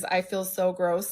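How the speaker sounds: normal talking voice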